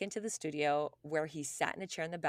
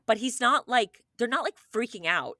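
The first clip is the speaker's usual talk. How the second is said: ordinary talk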